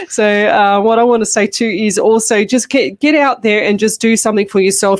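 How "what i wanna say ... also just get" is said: usual speaking voice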